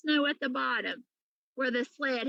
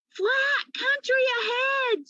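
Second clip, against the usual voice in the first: high, slow shout from the pillar top; cat shouts down from the ice; 13 semitones higher, slower than usual. A narrator's reading. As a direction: call far ahead across the plain. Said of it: "she shouted".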